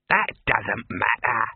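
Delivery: clipped dismissal of the point; sharp. Acted. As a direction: cut the objection dead. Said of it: "said Dibbler sharply".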